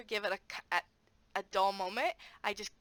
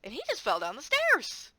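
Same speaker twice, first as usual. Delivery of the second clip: higher, teasing story-setup voice; playful teacher setup; 8 semitones higher, 4 dB louder than usual. Shown as a demonstration. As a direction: build a silly scene for the kids.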